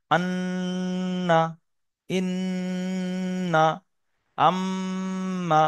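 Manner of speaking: long nasal hum held on the doubled n and m in আন্না, ইন্না, আম্মা; ghunnah demonstration. Shown as a demonstration. Teacher shows learners how ghunnah is done, long held nasal hum in আন্না, ইন্না, আম্মা. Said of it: "গুন্না করতে হবে".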